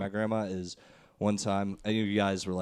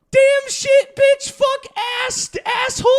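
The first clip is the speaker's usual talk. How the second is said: very high, louder grandma rattling off curses; grandma listing swears; 12 dB louder, slower than usual. Retold or imitated. Grandma reciting every swear to her ten-year-old grandson, shrill. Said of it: "She lists off every single swear".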